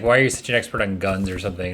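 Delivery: usual speaking voice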